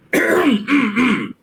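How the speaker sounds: pointed, high throat clear; mock-polite ahem. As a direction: clear your throat before correcting yourself. Clears throat before changing acted tone.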